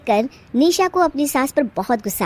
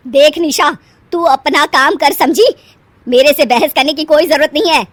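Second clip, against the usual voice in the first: stern mother-in-law; 3 semitones higher, 6 dB louder than usual. Acted. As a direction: lay down the law. Mother-in-law warns bride.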